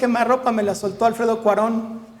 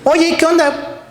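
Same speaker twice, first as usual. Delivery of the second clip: higher, louder friendly call; upbeat call; 7 semitones higher, 4 dB louder, slower than usual. Retold or imitated. A biologist inviting him to measure water: upbeat higher call. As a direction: hail a coworker.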